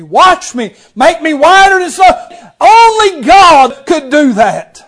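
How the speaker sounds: high, loud, slow preacher's shout; full-force preaching. Acted. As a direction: shout the climax to the congregation.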